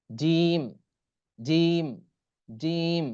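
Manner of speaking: জিম with a long, held ee; demonstrated pronunciation. Shown as a demonstration. Teacher modelling the letter for learners, long drawn-out জিম. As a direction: stretch the vowel of জিম evenly. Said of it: "এরকম উচ্চারণ হবে".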